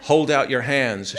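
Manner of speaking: loud, slow, commanding big sister; stern demand. A narrator's reading. Big sister orders the little ones to show hands, loud and stern. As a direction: bark the order like an interrogator. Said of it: "she demands".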